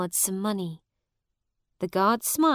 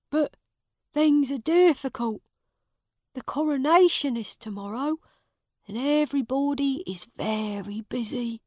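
put-on raised, anxious old servant voice; worried old servant; 6 semitones higher, 3 dB louder than usual. A narrator's reading. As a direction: tell it like a worried old servant sharing bad news.

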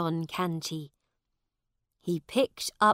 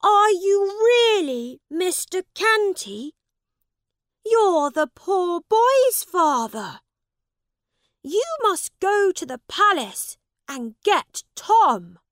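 astonished prince; 13 semitones higher, 12 dB louder than usual. Acted. Prince recognizes the beggar's father.

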